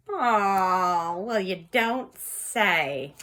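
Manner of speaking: loud, slow, drawn-out reaction; mock astonishment. Tarot reader reacting to a card, loud drawn-out mock surprise. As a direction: stretch it out loudly in mock surprise.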